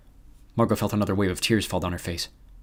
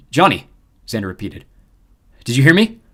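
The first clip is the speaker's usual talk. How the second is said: loud, urgent call; 4 semitones higher, 8 dB louder than usual. Acted. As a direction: demand a reply. Calling again over comms.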